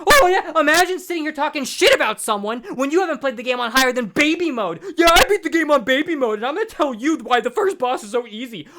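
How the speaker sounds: loud, high, hammed-up sarcasm; sarcastic rant. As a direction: fire back with over-the-top mock outrage. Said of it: "And it's like".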